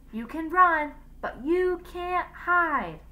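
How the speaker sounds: high, drawn-out taunting call; shouted threat. A narrator's reading. Teacher shouts after the escaping boat, menacing call. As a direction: call the warning across the water. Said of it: "she shouted into the darkness".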